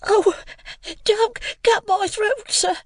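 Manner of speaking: put-on very high, frightened small boy's voice; pleading in terror. A narrator's reading. Pip begs the convict for his life, pleading in terror. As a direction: beg in a high, terrified child's voice. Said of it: "I pleaded in terror".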